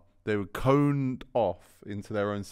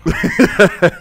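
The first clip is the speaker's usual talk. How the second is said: loud laugh; 12 semitones higher, 16 dB louder than usual. Real, not acted. Laughing at the story.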